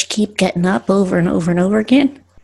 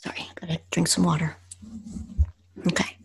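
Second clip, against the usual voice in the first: whispered aside; 13 dB quieter, faster than usual. Real, not acted. Aside during reading.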